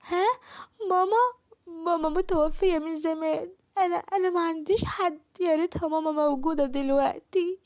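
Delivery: put-on a sick little girl pleading with the neighbour auntie; little girl, hurting and pleading; young girl in pain pleading. Child character pleading in pain.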